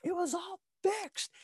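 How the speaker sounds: Mickey admitting the fights were fixed; old trainer confession. Retold or imitated. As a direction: squeak it out as a mock old man. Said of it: "he was like".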